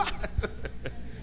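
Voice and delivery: short soft chuckle; breathy little laugh; demonstrative laugh. Shown as a demonstration. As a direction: laugh as if tickled by your own joke. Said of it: "ya usted se está riendo".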